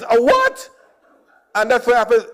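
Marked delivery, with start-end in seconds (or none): 0.0-0.7 high puzzled question. Retold or imitated. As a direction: sound baffled. Puzzled store lady.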